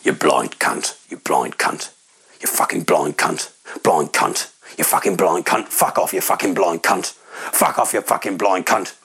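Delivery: low muttered abuse, looping; swearing tirade. Retold or imitated. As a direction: growl the insults under your breath. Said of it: "I can just hear this man next to me".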